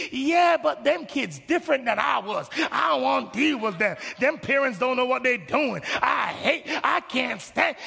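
heated, high-pitched mock complaining; acted indignant protest. Believer objects to them kids and parents, acting indignant. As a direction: argue back as if fed up.

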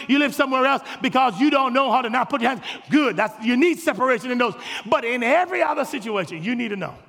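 usual speaking voice

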